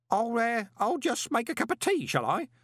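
high, hesitant offer to escape; flustered old postman. A narrator's reading. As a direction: offer it haltingly, high-pitched.

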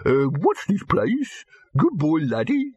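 high, slow doggy voice; pant, then lift each phrase at the end; dim pedigree dog. Acted. Wonder dog after its yap, high slow panting voice.

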